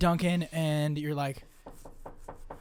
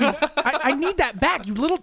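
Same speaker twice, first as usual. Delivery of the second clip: higher, half-laughing indignant demand; mock scolding; 8 semitones higher, 4 dB louder than usual. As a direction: playfully scold the little thief. Character demands her finger back.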